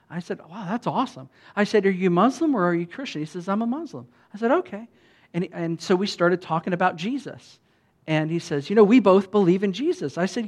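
ordinary talk, nothing marked

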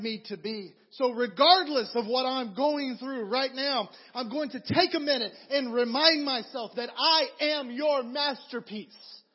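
loud, fervent prayer, slowing at the end. Acted. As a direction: pray aloud with full conviction. Voices a prayer to God.

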